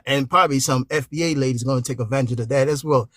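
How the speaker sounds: normal talking voice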